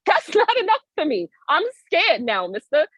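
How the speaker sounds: high-pitched comic protest; indignant protest. Retold or imitated. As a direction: push back high, playing up the grievance. Acted protest to the intruder.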